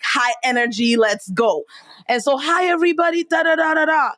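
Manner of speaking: usual speaking voice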